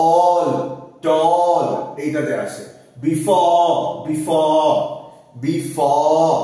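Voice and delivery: all, tall and before with a long, loud, drawn-out অ vowel; elongated o demo. Shown as a demonstration. Teacher drills long অ in before, loud and slow. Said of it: "ইলাংগেটেড অ".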